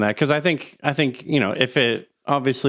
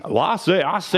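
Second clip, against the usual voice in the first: boastful cartoon rooster, lightly voiced; a hint of Foghorn Leghorn; Foghorn Leghorn impression; 3 semitones higher than usual. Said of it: "foghorn leghorn".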